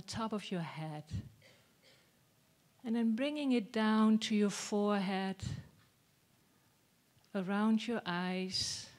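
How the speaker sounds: soft, unhurried body-scan voice with long pauses; guided meditation. Acted. Teacher leads group through body scan from the head, soft guided meditation. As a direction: guide them gently down through the body, softly.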